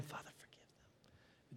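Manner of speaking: breathy whisper of a dying man; hushed plea from the cross; whispered prayer. Acted. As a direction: whisper it, pleading. Jesus's prayer voiced on cross.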